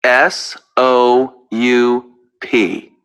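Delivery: letter by letter, slow and evenly spaced; spelling out. Shown as a demonstration. Answering how to spell soup, slowly spelled out.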